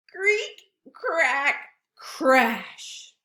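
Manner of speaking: loud sound-effect words; mock creak and crash. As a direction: build to the crash.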